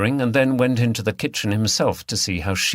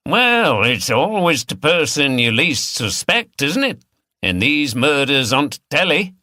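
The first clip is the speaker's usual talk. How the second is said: a higher, louder, knowing local teasing; teasing regular; 5 semitones higher, 4 dB louder than usual. Acted. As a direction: needle him like a pub wag. Regular teasing Vic as suspect.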